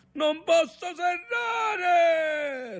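high, drawn-out cry of effort; strained storm cry. A narrator's reading. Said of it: "con la voce soffocata dalla tempesta e dallo sforzo". (grido acuto, soffocato dallo sforzo)

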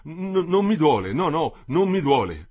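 high, loud, stammered insistence; pained denial. A narrator's reading. The wounded German is helped along, pained denial. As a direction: protest through the pain, drawing out the last words. Wounded man cursing, denying pain.

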